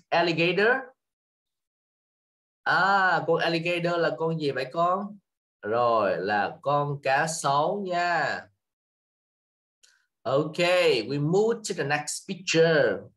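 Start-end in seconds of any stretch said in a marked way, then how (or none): none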